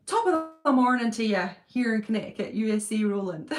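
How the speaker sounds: a light Irish lilt on the greeting; Irish accent. Retold or imitated. Reading a viewer's greeting in a light Irish accent. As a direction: greet them with a touch of Irish brogue. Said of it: "Nice wee Irish accent then".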